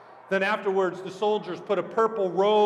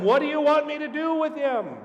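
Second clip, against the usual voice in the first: high, raised-voice question; exasperated appeal; 9 semitones higher, 4 dB louder than usual. Acted. Pilate appeals to the mob, calling out high. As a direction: call the question out to a crowd.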